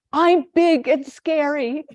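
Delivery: high, slow, strained bluff of toughness; trying to sound scary. Retold or imitated. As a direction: puff yourself up, unconvincingly. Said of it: "And he says".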